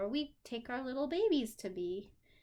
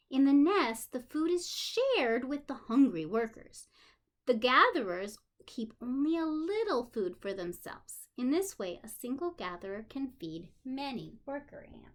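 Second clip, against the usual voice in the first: put-on reading to children; 5 dB louder than usual. A narrator's reading. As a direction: clear storybook reading. Reading a children's book aloud.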